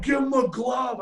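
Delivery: high-pitched mock call-out; mock demand. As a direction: call it out like an onlooker making demands. Retold demand from the crowd.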